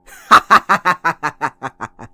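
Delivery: loud, gleeful villain cackle; villain laugh. Acted. Villain mocking the beaten hero, loud cackle. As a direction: throw back your head and cackle at the hero. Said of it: "I'll laugh anyway".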